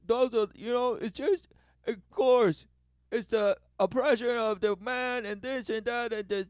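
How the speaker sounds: high, loud mocking rant. Mocking the complainers' rant, high and loud.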